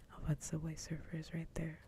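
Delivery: ordinary talk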